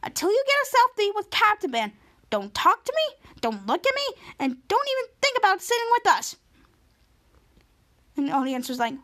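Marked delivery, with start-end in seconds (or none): 0.0-6.3 put-on high, haughty mean-girl voice; snooty mean girl. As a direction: talk down like the queen bee. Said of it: "Paula Macchiato said".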